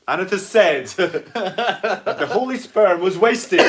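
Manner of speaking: loud, high, slow pronouncement through laughter; laughing mock scripture. Co-host improvising rude gospel, laughing mock proclamation. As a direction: proclaim it like scripture while cracking up.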